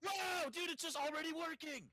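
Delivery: amazed. Real, not acted. Amazed mouse just works.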